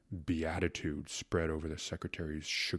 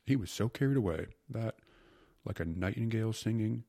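ordinary delivery

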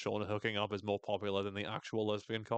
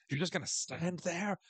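higher indignant question; scandalized Jackie; 7 semitones higher than usual. Retold or imitated. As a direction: demand it, affronted. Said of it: "is like".

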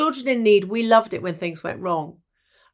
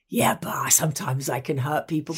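whispered confession; 5 semitones lower, 3 dB quieter than usual. Retold or imitated. Terry quoted confessing quietly.

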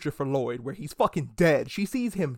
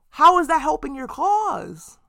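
louder, higher, slow disbelief; exasperated outburst; 11 semitones higher, 7 dB louder, slower than usual. Real, not acted. Vlogger ranting at the soup protesters, exasperated. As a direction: demand an answer in disbelief. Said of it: "exasperated rant".

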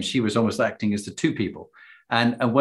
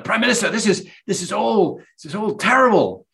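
high, rushed alarm, a little louder; a panicked aide breaking in on the prime minister; 11 semitones higher, 5 dB louder than usual. Retold or imitated. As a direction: burst in flustered with bad news. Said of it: "someone else rushes in".

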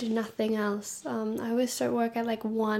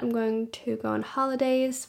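ordinary talk

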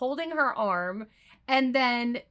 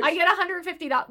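higher, fast mock yell; 7 semitones higher, much faster than usual. Abby screaming about the fine, hammed-up fast yell. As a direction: rattle it off like a frantic shout. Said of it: "she was screaming".

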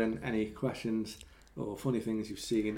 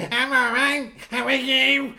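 high, loud, squeaky little voice; 11 semitones higher, 9 dB louder, slower than usual. Retold or imitated. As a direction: squeak it out like a nervous guy. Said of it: "that is not the fucking person".

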